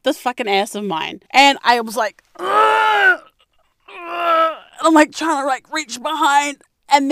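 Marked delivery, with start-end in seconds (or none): 2.4-4.6 effortful grunts; straining grunt. Retold or imitated. Straining to reach behind.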